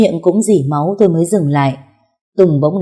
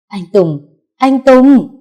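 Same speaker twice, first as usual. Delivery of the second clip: screaming a name; 7 semitones higher, 7 dB louder, much slower than usual. A narrator's reading. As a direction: shout rising to a loud cry. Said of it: "đang gào lên". (gào to gọi tên)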